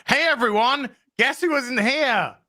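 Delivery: high-pitched mock show opener, drawn out at the end; high, sing-out intro impression; Perry Chans opening. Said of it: "like our Perry Chans when he does his openings".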